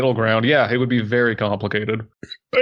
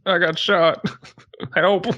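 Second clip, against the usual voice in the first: mock shot victim; 7 semitones higher, slower than usual.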